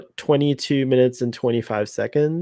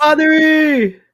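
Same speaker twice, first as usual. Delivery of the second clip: high, long drawn-out loud call; 14 semitones higher, 6 dB louder, slower than usual. Pretend. Calling Father E over, a high drawn-out holler. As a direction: holler the name, high and stretched.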